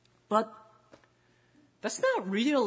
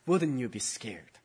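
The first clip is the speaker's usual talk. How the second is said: ordinary delivery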